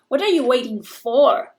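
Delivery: "for" said with full force, loud and slow; slow down and lean on the last word, "for"; stress on final preposition. Shown as a demonstration. Teacher showing question-final prepositions, stress on "for". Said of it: "hay que darle más fuerza".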